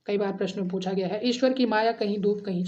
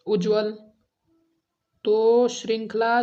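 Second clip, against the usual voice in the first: ordinary talk, nothing marked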